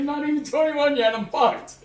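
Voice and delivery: high-pitched retold lament; mock dismay. Underage driver pulled over, high mock dismay. Retold panic at being caught.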